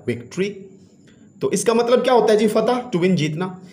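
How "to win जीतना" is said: normal talking voice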